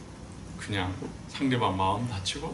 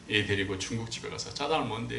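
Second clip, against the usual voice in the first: ordinary talk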